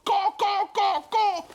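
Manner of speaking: high, loud bird caws; startled puffins cawing; squawking puffins. Acted.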